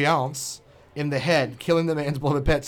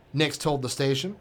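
ordinary talk, nothing marked

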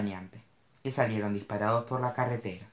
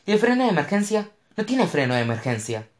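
high, loud panicked plea; panicked question; 8 semitones higher, 6 dB louder than usual. A narrator's reading. Mo begs Cash about the emergency brake in panic. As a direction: grab at any hope.

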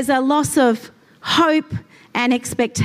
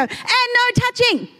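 high, slow warning; stern mum command; 10 semitones higher than usual. Retold or imitated. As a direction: drag out the no firmly. Mum ordering kids in car.

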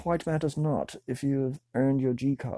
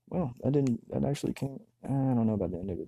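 ordinary talk, nothing marked

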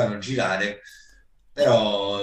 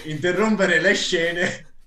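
laughing; 4 semitones higher than usual. Real, not acted. Laughing while retelling funny event.

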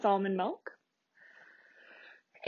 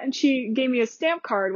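ordinary talk

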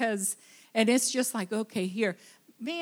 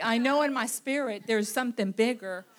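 ordinary delivery

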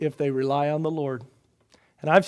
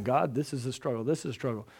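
hushed prayer; 5 semitones lower, 9 dB quieter, faster than usual. Shown as a demonstration. Softly modeling a prayer.

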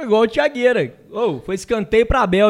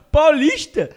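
incredulous; 8 semitones higher, 4 dB louder, much slower than usual. Real, not acted. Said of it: "entendi não".

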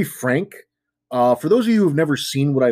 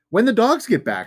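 fake casual; 11 semitones higher than usual. Acting casual asking friends.

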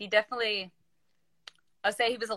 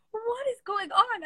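slow, very high-pitched bewilderment; retold incredulous reaction; baffled disbelief; slower than usual. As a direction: stretch it out in mock astonishment. Baffled reaction to cheerful coach.

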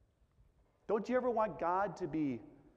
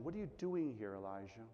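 soft, hushed voice of God; still small voice; 7 semitones lower, 7 dB quieter, faster than usual. Acted. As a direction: ask it gently, almost a murmur. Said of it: "the same question".

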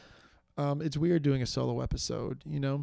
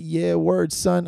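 mock street slang reply; 5 semitones higher, 7 dB louder, much slower than usual. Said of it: "I'm kidding".

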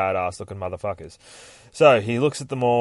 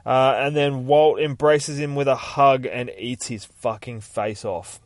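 ordinary delivery, nothing marked

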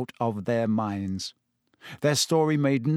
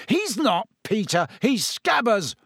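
yelling; 7 semitones higher than usual. A narrator's reading. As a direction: shout him down. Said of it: "Ron yelled".

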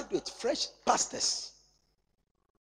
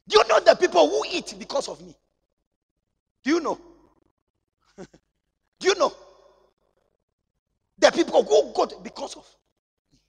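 emphatic preaching; 8 semitones higher, 9 dB louder than usual. Real, not acted. Preacher boasting at full force.

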